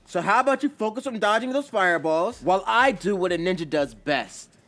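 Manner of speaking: high, loud, brash retort; heated, cocky comeback; yelling back. A narrator's reading. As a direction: snap back at a teammate, shouting. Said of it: "But Naruto yells back".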